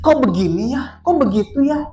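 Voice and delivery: high, loud mock complaining; exasperated complaint. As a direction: act out a baffled spouse protesting. Voices a disappointed spouse's complaint. (keluhan kesal bernada tinggi)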